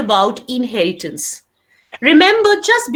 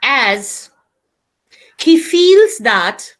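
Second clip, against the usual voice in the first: dictation pace. Shown as a demonstration. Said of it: "start writing".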